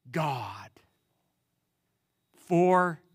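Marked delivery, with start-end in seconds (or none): 0.1-0.7 a hushed, breathy whisper on God. Acted. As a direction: let God fall to a bare whisper. Jesus names the absolute authority.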